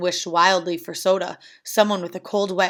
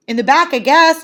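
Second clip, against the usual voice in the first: loud, high holler back; raised-voice shout; 7 semitones higher, 7 dB louder than usual. A narrator's reading. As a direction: holler the answer over roaring flames. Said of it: "I hollered".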